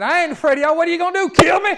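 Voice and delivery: high, loud, taunting challenge; defiant shout. Retold or imitated. Paul shouting down the men who want him dead, defiant. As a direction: shout it like a fearless apostle daring them. Paul defying his enemies, acted.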